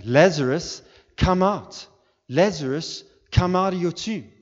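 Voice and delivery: high, loud summons; forceful preacher's shout, each phrase punched; calling out. Acted. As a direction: call the dead out at full voice. Preacher voices Jesus calling Lazarus out.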